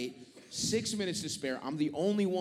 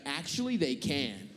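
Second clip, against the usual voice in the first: condescending clerk; 8 semitones higher than usual. Retold or imitated.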